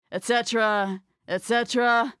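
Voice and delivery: et cetera, et cetera in an undead drone; zombie voice. Acted. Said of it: "gotta do my zombie voice now".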